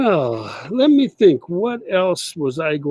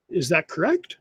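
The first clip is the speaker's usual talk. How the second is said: ordinary talk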